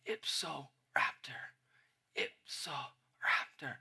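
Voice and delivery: whispered last words, slow and faint; hushed dying whisper, drawn out; dying mutter. Acted. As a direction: mutter it like a dying man, all breath. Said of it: "he mutters this phrase".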